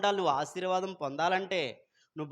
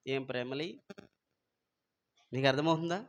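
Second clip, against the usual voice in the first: ordinary talk, nothing marked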